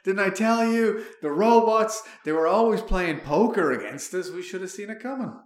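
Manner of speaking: loud, high-pitched told-you-so outburst; mock I-told-you-so rant. Host to everyone after the singularity, loud gloating rant. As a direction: rail at everyone like a vindicated doomsayer. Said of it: "I'm just gonna be like".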